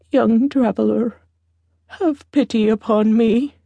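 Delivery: lightly voiced old woman begging; a touch of pleading, as an old beggar. A narrator's reading. Old beggar woman begs for help.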